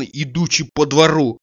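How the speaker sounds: ordinary delivery, nothing marked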